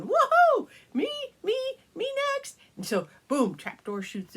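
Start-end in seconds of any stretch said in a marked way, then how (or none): 0.0-2.6 squealing high woohoo; excited child. Acted. Lindy begging for another slide turn, squealing. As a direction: shriek with delight like a kid. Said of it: "Lindy's going".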